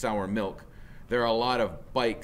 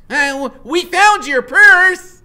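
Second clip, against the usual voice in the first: much higher, very loud slow blurt; blurting nervous boy; 18 dB louder, slower than usual. Acted. Scared boy blurting to the biker, high and loud. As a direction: burst it out in panic. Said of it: "Freak blurts out".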